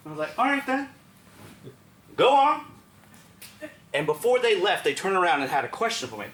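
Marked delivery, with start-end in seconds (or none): 0.4-2.6 high, loud send-off; commanding dismissal. Retold or imitated. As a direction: order them away firmly. Sends the boys off.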